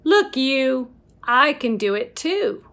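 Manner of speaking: ordinary delivery, nothing marked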